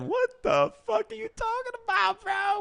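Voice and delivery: high, drawn-out disbelieving outburst; incredulous outburst. Retold or imitated. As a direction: react in high-pitched, acted-out alarm. Retold outburst at alarming advice.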